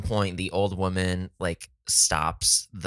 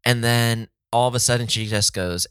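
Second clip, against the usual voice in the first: ordinary talk, nothing marked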